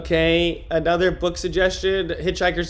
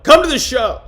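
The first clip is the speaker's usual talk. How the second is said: calling out; 7 semitones higher, 7 dB louder than usual. Real, not acted. Plugging his comedy show.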